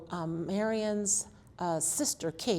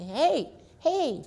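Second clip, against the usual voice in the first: hollering; 8 semitones higher, 4 dB louder than usual. Retold or imitated. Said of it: "she hollered at me".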